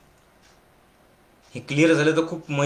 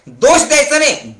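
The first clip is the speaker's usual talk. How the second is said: emphatic command; 9 semitones higher, 5 dB louder than usual. Acted. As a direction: hammer home each word.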